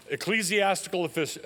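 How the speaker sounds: normal talking voice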